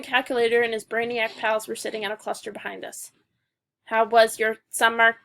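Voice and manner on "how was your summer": slow, loud robot voice. Acted. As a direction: talk like a stiff robot. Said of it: "I asked him in a robot voice".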